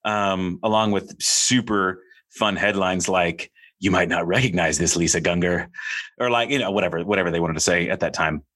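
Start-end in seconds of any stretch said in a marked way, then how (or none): 3.8-5.7 put-on headline tone, slightly lowered; lightly mocking headline read. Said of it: "read it in that particular tone of voice".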